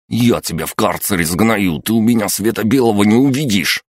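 furious threat. A narrator's reading. Guard threatening after yelling.

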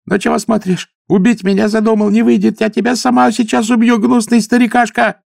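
high hysterical screaming; breaking into screams. A narrator's reading. Possessed daughter screaming threats at the old man. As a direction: shriek threats at him. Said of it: "срываясь на крик".

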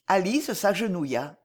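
usual speaking voice

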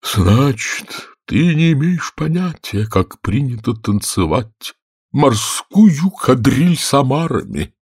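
weepy storybook creature; Черепаха Квази, tearful. A narrator's reading. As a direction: draw it out sorrowfully. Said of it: "обливаясь слезами, заговорил".

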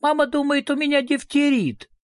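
put-on high, wailing little girl; acted tearful outcry; bawling exclamation. A narrator's reading. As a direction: wail the complaint in a high child's voice. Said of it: "разревелась и воскликнула".

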